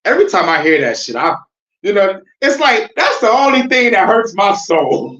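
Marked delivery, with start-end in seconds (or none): none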